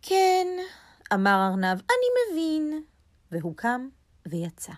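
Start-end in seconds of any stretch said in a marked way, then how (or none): 0.0-0.5 high, louder little rabbit. A narrator's reading. Rabbit concedes to the squirrel, high rabbit voice. 1.8-2.8 high, slow little rabbit. A narrator's reading. Rabbit concedes to the squirrel, slow and high.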